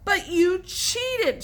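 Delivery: high, loud, slow accusation; shouting. A narrator's reading. Tom shouting at Alan and Joe, loud accusing. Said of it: "shouted Tom".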